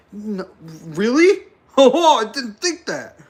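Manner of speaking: slow, rising, mock shocked; dripping sarcasm; sarcastic surprise. Real, not acted. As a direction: fake astonishment.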